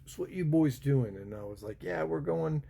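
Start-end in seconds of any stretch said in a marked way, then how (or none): 0.0-1.2 higher, louder English copper's challenge; British bobby. Retold or imitated. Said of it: "the bobbies came up to me and they were like". 1.8-2.6 higher, exaggerated American accent; thick American accent. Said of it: "I put on the thickest American that I had".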